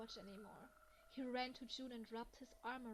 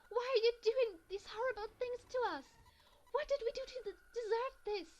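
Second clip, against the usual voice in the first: far higher, much louder anguished shriek; desperate scream; 11 semitones higher, 13 dB louder than usual. Acted. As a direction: wail the question at your captors. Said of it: "She screamed again".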